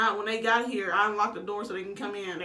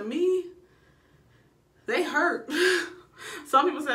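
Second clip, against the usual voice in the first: laughing; 7 semitones higher, 4 dB louder than usual. Real, not acted. Recalling to viewers how much contractions hurt, laughing. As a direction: voice up high and louder, half laughing.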